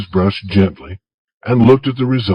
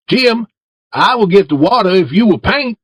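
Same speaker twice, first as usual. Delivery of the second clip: put-on higher, boyish voice for Tom; Tom asking Jim; 11 semitones higher than usual. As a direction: coax Jim in a raised boy's voice.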